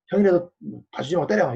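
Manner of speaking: high, loud imitated parent egging on a child; mock parent shout. Imagined parent yelling at the younger brother, high and loud. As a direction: belt it out like a parent urging a fight. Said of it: "이러는 엄마, 아빠는 없잖아".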